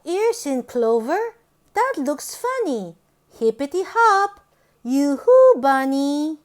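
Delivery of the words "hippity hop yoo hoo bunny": bouncy call. Acted. Storyteller calling playfully to the bunny.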